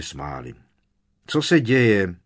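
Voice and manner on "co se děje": prudce a hlasitě vyhrknout; vyhrknutí. A narrator's reading. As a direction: vyhrkni otázku naléhavě a hlasitě. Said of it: "vyhrkl Fred". (Fred polekaně vyhrkne na příchozí)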